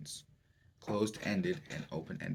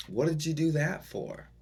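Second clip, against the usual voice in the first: accusing; 6 semitones higher, 3 dB louder than usual. Shown as a demonstration. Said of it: "just think of another one".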